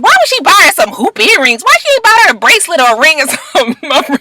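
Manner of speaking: high-pitched mock outrage, breaking into a laugh; hammed-up indignation, pitched high; imitating mad girlfriend. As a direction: mimic an upset girlfriend's incredulous complaint. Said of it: "my girlfriend was so mad".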